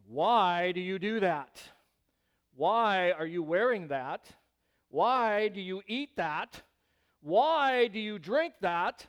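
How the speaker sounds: drawn-out, pointed "why"; slow, judgmental questioning with weight on WHY; sanctimonious questioning. Acted. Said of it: "In all of our sanctification".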